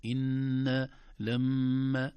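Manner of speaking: "إن" and "لما" with the doubled ن and م hummed long; held nasal ghunna. Shown as a demonstration. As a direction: hold the hum in "إن" and "لما". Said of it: "إلا النون والميم بسبب الغنة". («إن» و«لما» بغنة ممدودة)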